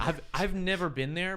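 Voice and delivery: normal talking voice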